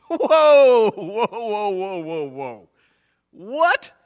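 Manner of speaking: loud high "whoa" tumbling down, then high "what?"; acted alarm, calling a halt in disbelief; shocked objection. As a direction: burst in loudly, hammed-up shock. Acts out startled listeners interrupting.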